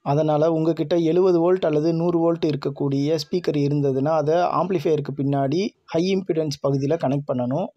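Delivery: normal talking voice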